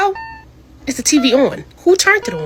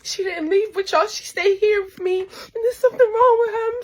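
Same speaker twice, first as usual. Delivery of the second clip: high, hushed frightened; terrified daughter warning; scared; 9 semitones higher, 4 dB quieter than usual. Acted. Daughter warns dad, high hushed fear.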